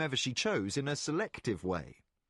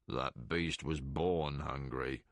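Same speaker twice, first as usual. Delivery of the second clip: put-on much lower and slow, dry growl of an old man; gruff Meacham; 12 semitones lower, 4 dB quieter, slower than usual. Meacham grumbling low about the hungry beast.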